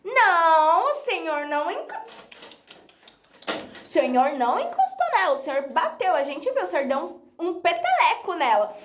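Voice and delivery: hammed-up protest, opening high and loud; soap-opera indignation. Friend confronting the teacher over the flick, mock-dramatic indignation. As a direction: accuse him like an overacting TV actress. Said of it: "que era muito da Globo também".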